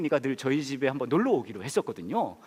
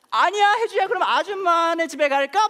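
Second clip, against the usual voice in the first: very high, loud imitation of a panicking neighbour; hammed-up alarm, voice shooting up; alarmed woman; 12 semitones higher, 7 dB louder than usual. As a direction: mimic a woman urgently coaxing a child away. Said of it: "얼굴이 완전히 놀라가지고".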